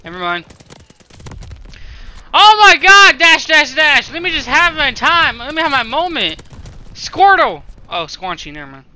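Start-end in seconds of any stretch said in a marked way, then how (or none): 2.3-6.4 loud, high, pleading shout; high-pitched panicked pleading; frantic yelling. Real, not acted. Begging for time while being attacked. 6.9-7.6 loud, high call; sudden high exclamation; shouted name. Real, not acted. Calling out a name.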